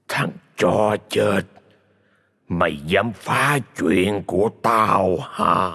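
slow, drawn-out menacing spirit voice; a vengeful ghost growling from beyond, very slow; low murky ghost voice. Acted. Vengeful spirit threatens the dying husband, slow menacing voice. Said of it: "một giọng nói trầm đục".

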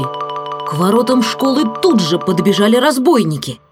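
ordinary delivery, nothing marked

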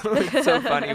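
giggling through the words; laughing. Real, not acted. Laughing while talking.

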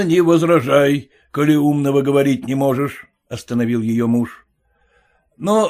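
ordinary delivery, nothing marked

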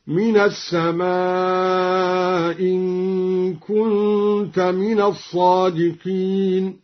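"السماء" with a long-held aa, slow chant; lengthened madd. Shown as a demonstration. Teacher showing the madd option, "السماء" chanted with long-held aa. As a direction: stretch the aa of "السماء", dropping its hamza before "إن". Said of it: "على القصر والمد هكذا".

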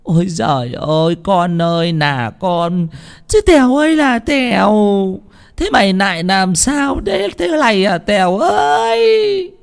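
high, drawn-out mother's lament; tearful wailing. A narrator's reading. Mother finds her son lying hurt, tearful wailing. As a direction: wail it out, stretching the cries and climbing very high. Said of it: "Bà Vân mếu máo".

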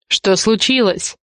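slow, gently insistent question; mildly demanding, with a soft edge; demanding yet soft. A narrator's reading. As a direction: ask firmly but softly, taking your time. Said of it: "звучал требовательно, но вместе с тем мягко".

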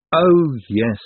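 plain reading voice

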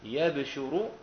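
"يبشر" as yabshuru, with a unstressed sheen and silent-vowelled ba; light Hamza reading. Shown as a demonstration. Teacher shows Hamza's reading to students, "يبشر" said lightly as yabshuru. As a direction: say "يبشر" yab-shu-ru, no doubled sheen. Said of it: "بفتح الياء وسكون الباء وضم الشين مع التخفيف".

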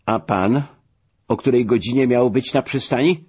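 mildly sharp, challenging him; sharp retort. A narrator's reading. Admiral challenging the latecomer about the pier, sharp. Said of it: "rzekł ostro admirał".

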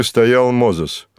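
ordinary delivery

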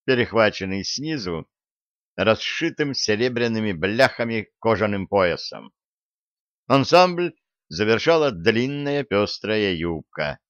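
plain reading voice

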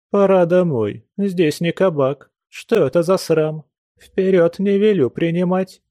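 stern scolding. A narrator's reading. Landlady orders the drunk guest home, scolding sternly. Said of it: "строго сказала она".

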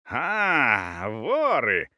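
mild narrated roar of a furious husband; a touch of outrage, drawn out; understated fierce yell. A narrator's reading. Husband discovers thieves at his door, mildly fierce yell. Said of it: "заорал свирепый, почти обнажённый муж".

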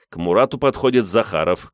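plain reading voice

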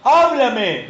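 loud, high cry to God; clamor a Dios. Acted. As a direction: call out loudly to heaven, acted. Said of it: "que dice". (clamor fuerte y agudo, actuado)

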